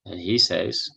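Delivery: ordinary talk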